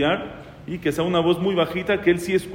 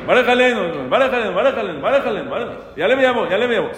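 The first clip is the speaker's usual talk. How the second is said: higher, louder mock yelling of the crowd; mock shouting; 7 semitones higher, 5 dB louder, faster than usual. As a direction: ham up a noisy crowd all calling out. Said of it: "cada uno que llega grita". (gritos exagerados de imitación)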